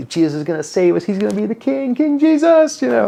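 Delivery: hammed-up hopeful crowd; mock excitement. Mocking expectations of king.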